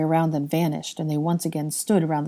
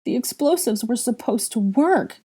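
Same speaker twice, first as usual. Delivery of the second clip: high, anguished wail; a mournful wail; 6 semitones higher than usual. A narrator's reading. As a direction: cry out in despair. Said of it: "Hermione wailed".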